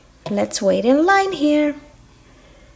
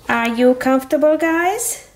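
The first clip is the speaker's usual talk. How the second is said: normal talking voice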